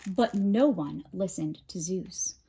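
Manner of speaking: ordinary delivery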